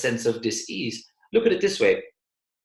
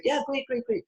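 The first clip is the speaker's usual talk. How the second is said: much higher and softer, forced yeah great; forced cheer; 12 semitones higher, 4 dB quieter than usual. Retold or imitated. Salesperson answering Eric's call, forced hollow cheer. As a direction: squeeze out a hollow reassurance. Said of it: "And it's like".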